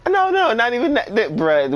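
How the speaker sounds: laughing. Real, not acted. Laughing denial at the GoFundMe idea.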